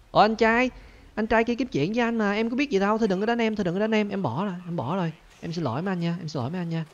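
high, hammed-up begging voice; high mock plea to the angry fisherman; mock pleading. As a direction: plead like a scared little brother. Begs angry fisherman, in-game.

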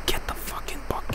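very soft, whispered cursing; hushed, irritated muttering; seething whisper. Real, not acted. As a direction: whisper orders through your frustration. Seething at players under breath.

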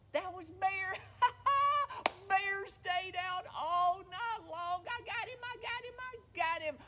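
high, loud crowing with cackles; gleeful gloating. Acted. Rabbit finds Bear frozen in the snow, gleeful gloating. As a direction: crow over a prank that worked.

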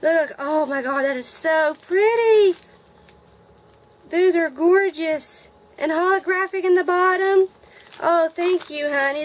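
high, loud gushing over the glitter; real excitement. Real, not acted. Vlogger showing glitter her friend sent, gushing excitedly. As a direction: swoon over how pretty it is. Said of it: "I'm so excited".

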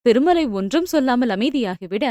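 ordinary delivery, nothing marked

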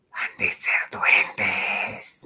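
slow, soft whisper; aunt's ominous whisper; spooky whisper. Retold or imitated. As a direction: breathe it like an omen. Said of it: "voltea la tía y dice".

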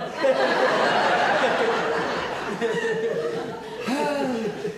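long, trailing chuckle; long laugh. Acted. Man laughing after his sash song, long chuckle. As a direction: laugh on and on at your own joke.

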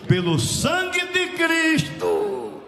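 loud, drawn-out revival cadence; impassioned preaching. Real, not acted. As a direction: proclaim it at full force, stretching key words. Preaching at full force.

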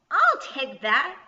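loud, high, slow goblin demand; grabbing goblin. A narrator's reading. Goblin snatching yarn from Kirsty, loud demand. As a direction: demand it loudly, stretching the words.

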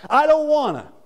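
high, loud whining; high mock whine; whiny reluctance. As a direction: sulk like a child. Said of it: "Nevertheless, at thy word".

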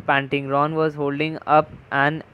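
ordinary delivery

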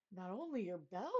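ordinary delivery

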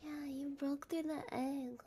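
ordinary talk

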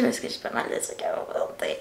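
quick hushed whisper to the camera; whispered aside. Real, not acted. Vlogger hurrying through her list, whispering aside to viewers. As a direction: drop to a secret whisper, hurrying.

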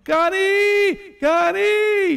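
high, loud calling out for a lost friend; desperate high call, searching; yelling a name. Retold or imitated. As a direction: shout her name across the field. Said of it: "And I was yelling".

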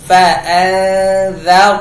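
ฟะอันซับ drawn out very slowly, nun held in the nose; held nasal ikhfa. Shown as a demonstration. Teacher slowly models ikhfa in ฟะอันซับ. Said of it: "อ่านหน่วงนูน".

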